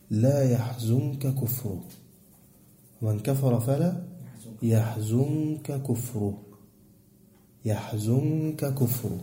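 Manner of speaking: slow, soft chanted verse; teacher reciting the verse softly and slowly; Quran recitation. Acted.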